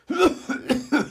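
hammed-up coughing fit; acted coughing. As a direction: cough as if in dust under the bed. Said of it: "begann sofort kräftig zu husten".

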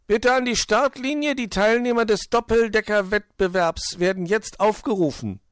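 high, loud mock announcer; calling out. A narrator's reading. Borstel summons the racers to the start, loud high call. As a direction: shout it to the whole starting line. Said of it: "rief Borstel".

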